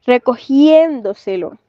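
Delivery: "recogiéndoselo" as a demo, strong GIÉN; stress fourth from end. Shown as a demonstration. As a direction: hit GIÉN in "recogiéndoselo", then tail off. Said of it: "el acento está en la cuarta sílaba desde el final".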